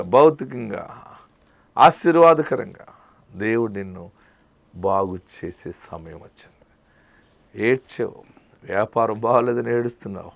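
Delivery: ordinary delivery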